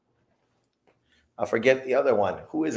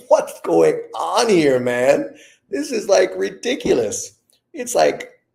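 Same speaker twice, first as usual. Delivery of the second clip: high, slow, incredulous outburst; stunned disbelief; 8 semitones higher, 5 dB louder, slower than usual. Retold or imitated. Back from the fancy hotel, reliving the shock, high and incredulous. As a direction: let the disbelief pitch you way up.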